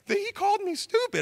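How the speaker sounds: very high-pitched mock-tearful child voice; crying little girl. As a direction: imitate a little girl whining through tears. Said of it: "this little girl's crying".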